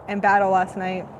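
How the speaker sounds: normal talking voice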